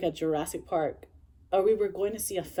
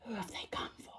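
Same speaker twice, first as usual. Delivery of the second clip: hushed, ominous trailer whisper; eerie whispered repeat; movie advert whisper; 4 semitones higher, 15 dB quieter than usual. Retold or imitated. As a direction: whisper it like a film advert's last line.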